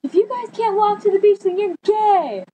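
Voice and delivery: high-pitched mocking jeer. Acts out friend taunting strangers.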